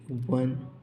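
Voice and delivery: ordinary delivery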